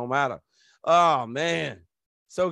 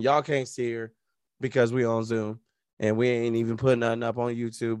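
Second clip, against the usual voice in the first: ordinary talk, nothing marked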